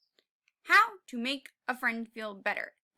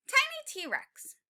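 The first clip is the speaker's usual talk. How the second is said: tiny voice; 12 semitones higher, 3 dB louder, slower than usual. Shown as a demonstration. Teacher reads title with voice.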